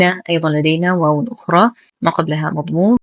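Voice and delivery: ordinary talk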